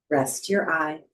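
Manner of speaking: ordinary talk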